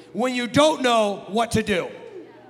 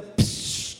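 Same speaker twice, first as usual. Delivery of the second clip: long imitated fuse hiss; spray out a long "pshhh"; firework hiss; 3 dB quieter than usual. Storyteller mimicking a lit firework, drawn-out hissing noise.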